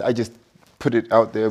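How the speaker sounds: ordinary talk, nothing marked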